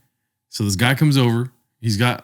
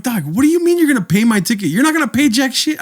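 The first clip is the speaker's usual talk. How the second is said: imagined retort; 12 semitones higher than usual. Retold or imitated. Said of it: "I didn't say this".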